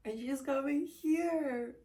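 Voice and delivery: a happy outburst about a friend arriving; excited. Real, not acted. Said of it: "I'm really, really excited".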